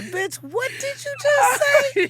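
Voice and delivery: high, slow, stretched indignant demand; inner outrage. Retold or imitated. Her thoughts on hearing the insult, high slow outrage. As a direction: draw it out in shocked fury.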